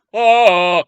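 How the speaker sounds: loud, high "oh" holler; loud imitated cry. Imitating Dundee's answer to Jane remark, loud cry. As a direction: belt out one big cry. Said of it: "he was like".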